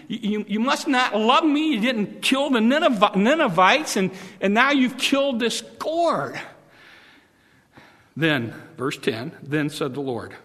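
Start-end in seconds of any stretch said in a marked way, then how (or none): 0.1-6.4 high, sulky accusing whine; angry complaint. Acted. Jonah accusing God, high sulky whine. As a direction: accuse God of not loving you.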